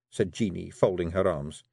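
usual speaking voice

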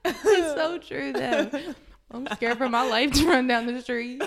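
words squeezed out through laughter; giggling through the sentence; laughing while talking. Real, not acted. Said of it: "why I'm laughing that hard".

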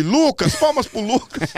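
high, loud game-show host imitation; hammed-up announcer calling for applause; Silvio announcing. Silvio mishears the kid's name and asks for applause, high loud announcing.

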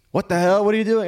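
high, loud, panicked family shriek. Acted. Family members shrieking at the armed intruder in panic. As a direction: shriek it out in terror.

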